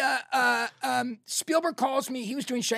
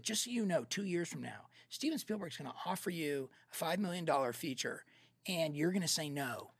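low, much softer, measured; hushed retold prophecy; 5 semitones lower, 11 dB quieter than usual. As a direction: deliver it quietly like sobering news. Imagined prophecy while sitting thinking alone.

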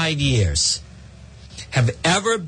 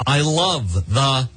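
"I love the" stretched very slowly, then a long gap before "pause."; mock quote, very slow; much slower than usual. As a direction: drag out a mock fan's line with a long beat. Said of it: "Such as they say".

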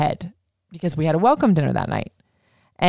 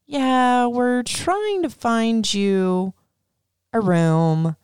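higher, slow, hesitant clerk voice; hotel clerk; 6 semitones higher, much slower than usual. Retold or imitated. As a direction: stall awkwardly like a front desk clerk. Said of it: "they're like".

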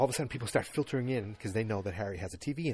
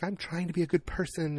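pleading; 8 semitones higher, 4 dB louder than usual. Retold or imitated. Jane pleading with Roger.

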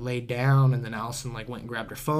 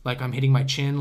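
ordinary talk, nothing marked

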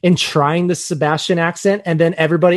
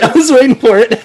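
repeated through giggles, a bit higher; laughing; 7 semitones higher, 9 dB louder than usual. Real, not acted. Cohost laughs at the crab joke, repeating it while laughing. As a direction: laugh it out a second time.

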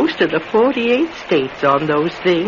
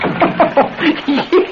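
amused laugh; 11 semitones higher than usual. Acted. Old man laughs at riches.

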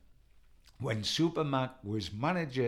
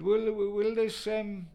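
imitating Jack Charlton; 10 semitones higher than usual. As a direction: draw it out high like a tight old gaffer. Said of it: "He said".

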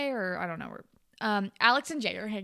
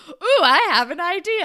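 loud, high, singled-out exclamation; hammed-up eureka, high and very loud; mock excited brainwave; 9 semitones higher, 13 dB louder than usual. Acting stoned friend's sudden idea.

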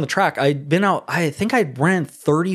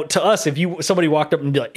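ordinary delivery

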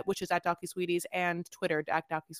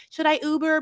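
high, louder timid question; mock Puppy asking; 10 semitones higher, 9 dB louder than usual. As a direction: ask it high and loud, unsure. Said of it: "Puppy is like".

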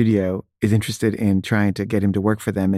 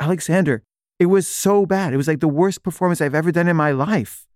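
high-pitched exasperated pleading; retold anguished complaint, pitch jumping up; desperate protest; 6 semitones higher than usual. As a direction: plead with the director, appalled. Actor argues, more desperate.

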